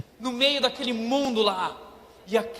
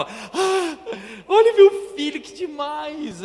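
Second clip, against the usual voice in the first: much higher and louder, gushing over his son; proud dad; 7 semitones higher, 8 dB louder, much slower than usual. Retold or imitated. Dad gushing over his son tying his shoes, high and loud. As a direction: gush like a dad amazed at his little boy.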